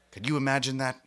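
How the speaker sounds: ordinary delivery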